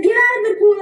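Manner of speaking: high, loud command; barked order. Retold or imitated. Coach ordering the swimmer out of the pool, high and loud. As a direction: order her out of the water.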